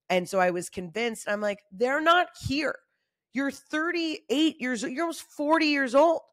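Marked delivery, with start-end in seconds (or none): 1.7-6.2 firm self pep talk. Retold or imitated. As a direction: talk yourself out of the fear. Self-talk, telling herself off.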